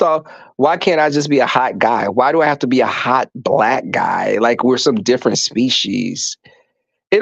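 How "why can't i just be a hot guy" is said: ordinary talk, nothing marked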